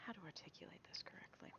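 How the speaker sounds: whispering to herself; whispered aside. Real, not acted. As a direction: mutter it under your breath. Muttering to self.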